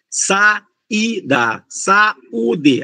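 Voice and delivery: "Saída, saúde" broken up as sa-í-da, sa-ú-de; syllable by syllable. Shown as a demonstration. Teacher showing students how the hiatus splits, "Saída, saúde" syllable by syllable. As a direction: say "Saída, saúde" slowly, each vowel its own beat. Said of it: "você vai separar as duas sílabas".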